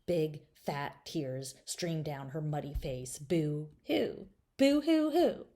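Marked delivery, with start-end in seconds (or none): 3.2-5.5 high fake boo-hoo sobs; girl in the mud sobbing boo-hoo; mock sobbing boo-hoo. A narrator's reading. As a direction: sob it out like a storybook crybaby. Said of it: "Linda Lee started to cry".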